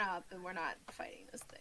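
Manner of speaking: whispered aside; whispering. Real, not acted. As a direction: hush it to the table. Secret aside to party.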